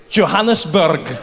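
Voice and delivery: slow, loud mock Scottish; hammed-up Scottish accent; bad Scottish accent. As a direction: butcher a Scottish accent, drawn out. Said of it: "speak to people in their accent".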